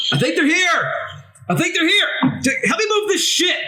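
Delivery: high, urgent yelling for help; gruff shout. Acted. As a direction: shout it out, frantic to be heard. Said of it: "a very gruff voice".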